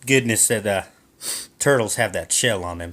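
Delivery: ordinary talk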